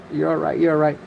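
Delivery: softly reassuring a scared bird; calm the flapping quail down gently; soothing. Real, not acted. To his startled quail, a soft soothing hush.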